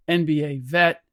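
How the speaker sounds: ordinary talk, nothing marked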